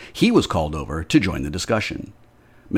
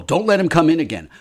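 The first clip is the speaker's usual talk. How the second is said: higher, quick frightened plea; panicked, fast plea; 10 semitones higher, 6 dB louder, faster than usual. Acted. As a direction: blurt it out in fear. Panicked landlady pleading.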